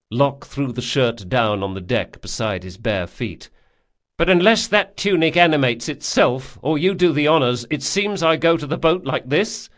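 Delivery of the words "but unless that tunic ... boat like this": higher, louder, petulant jab; sarcastic sulking man. Acted. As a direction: needle him with mock helplessness. Locke sulks sarcastically.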